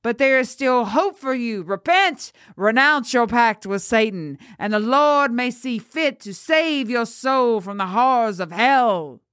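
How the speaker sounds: high, swooping preacher's appeal; crying out. A narrator's reading. Priest urging the girl to repent, crying out high. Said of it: "who cried out to the girl".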